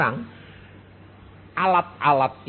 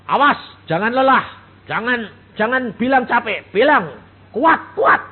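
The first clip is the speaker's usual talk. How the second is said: wife's warning; 8 semitones higher, 8 dB louder than usual. Retold or imitated. Wife warns tired husband on phone.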